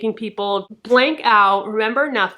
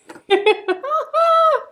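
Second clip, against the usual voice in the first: high, emotional laugh; tearful giggle. Real, not acted. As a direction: laugh out the happy tears. Laughing after the cheer.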